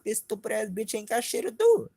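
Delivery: high-pitched retold outrage; pissed off. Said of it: "that shit pissed me off".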